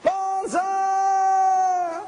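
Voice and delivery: high, loud, drawn-out cheer; yelled banzai. Retold or imitated. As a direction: shout it to the rising sun. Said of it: "watching them all yell".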